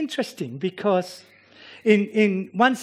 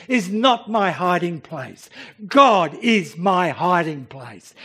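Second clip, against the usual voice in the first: ordinary talk, nothing marked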